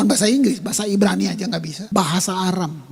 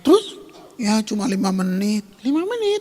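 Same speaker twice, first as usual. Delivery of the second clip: mock incredulous. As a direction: scoff at how short the prayer was. Mock questioning of prayer time. (nada tinggi tak percaya mengejek)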